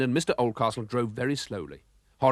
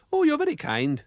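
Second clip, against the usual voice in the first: much higher sweet lady voice; gracious lady monster. Acted. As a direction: thank him like a kindly lady. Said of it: "she said".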